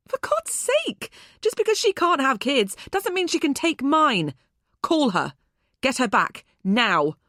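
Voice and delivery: high, loud, exasperated cry; furious demand. A narrator's reading. Furious demand to call daughter.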